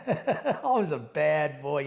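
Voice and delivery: laughing slowly through the words; a guilty chuckle while owning up. Real, not acted. Confessing his wild past to the host, laughing.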